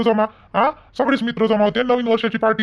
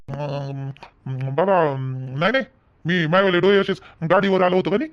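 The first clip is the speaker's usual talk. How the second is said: ordinary talk, nothing marked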